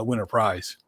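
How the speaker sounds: ordinary talk, nothing marked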